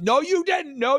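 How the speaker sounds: high-pitched mock shouting match; imitated yelling argument. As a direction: imitate a stubborn, hollered denial. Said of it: "They're just yelling".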